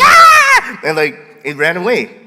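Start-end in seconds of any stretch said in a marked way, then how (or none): 0.0-0.8 very high, loud shriek; kids' scream. Retold or imitated. Pastor imitating frightened kids running away, high shriek. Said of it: "And they're like".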